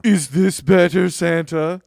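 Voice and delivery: loud, slow, cheeky question; sassy question to Santa; mock sassy question. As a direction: ask Santa with cheeky pride. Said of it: "And just be like".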